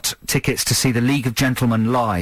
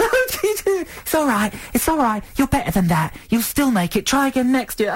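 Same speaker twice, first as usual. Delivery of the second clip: high, sing-song consoling parent voice; mock consoling parent; 11 semitones higher than usual. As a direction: coo like a parent comforting a crushed kid. Mocking the parents consoling the boy.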